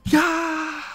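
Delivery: yells out high and loud; triumphant shout. Real, not acted. Streamer screams as his predicted card appears, high shout. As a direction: blast it out in victory.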